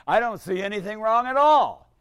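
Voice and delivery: rising up high and loud in play-acted outrage; mock indignation. A priest acting out an offended parishioner, building to a loud mock outburst. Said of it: "Now I'm even madder".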